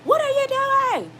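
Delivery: loud, high-pitched parental scream; screaming at your kid. Acted. Mom demonstrating constant screaming at her kid, acted shrieking yell. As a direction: yell it way up high, stretching the words. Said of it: "always screaming at your kid".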